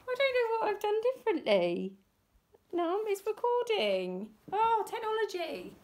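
real frustration with a recording that keeps failing; exasperated. Real, not acted. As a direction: throw up your hands at technology. Recording failure, exasperated aside.

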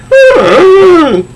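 very high, loud villain cackle; hammed-up comic-villain cackle; evil cackle.

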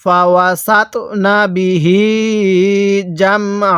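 measured chant with the last "hee" of বিহি held; slow Quran recitation. Shown as a demonstration. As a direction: recite slowly, stretching the end of বিহি. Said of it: "আমার সাথে মিলিয়ে পড়ুন".

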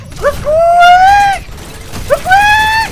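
tiny hamster battle cry; squeaky hamster cry. Pretend.

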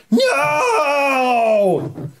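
high screaming no. Acted. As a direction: shriek it out in panic. Kid runs screaming.

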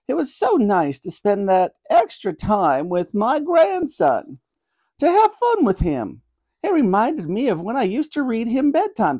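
high-pitched, wistful grandmother voice; an old granny fondly remembering, apologetic. Acted. Granny explaining her lie about her grandson, wistfully.

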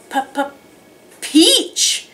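"p-, p-, peach", first sound repeated alone, then the word. Shown as a demonstration. Teacher giving children a first-sound clue, sounding out "p-, p-, peach". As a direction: puff out "p-, p-" before a high "peach".

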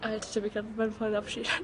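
ordinary talk, nothing marked

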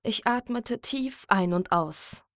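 ordinary talk, nothing marked

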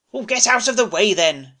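a bitter, loud brush-off; bitter snap. A narrator's reading. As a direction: spit it out bitterly. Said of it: "Seymour said bitterly".

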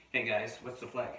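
plain reading voice

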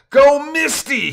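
very high, loud, drawn-out yell; slow, stretched shout; screaming cheer. A narrator's reading. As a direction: scream encouragement down the line. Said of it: "he screams to his descending wife".

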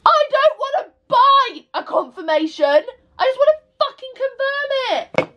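high, exasperated rant. Real, not acted. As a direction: rant it out, fed up. Angry rant, high pitch.

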